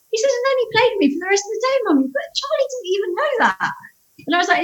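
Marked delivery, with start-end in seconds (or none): 0.3-3.7 put-on excited child. Son voiced excited.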